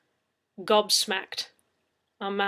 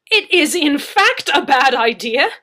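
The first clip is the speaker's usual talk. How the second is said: cried out; 6 semitones higher, 16 dB louder than usual. Acted. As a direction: cry out against the plan. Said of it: "I cried".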